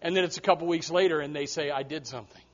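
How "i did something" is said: tearful confession. Retold or imitated. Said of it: "And they're in tears".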